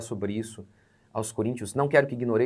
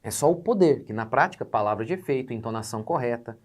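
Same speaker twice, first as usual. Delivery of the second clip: usual speaking voice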